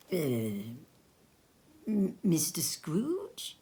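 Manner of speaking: put-on old gentleman startled to meet Scrooge; startled whisper. A narrator's reading. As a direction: stammer his name in disbelief.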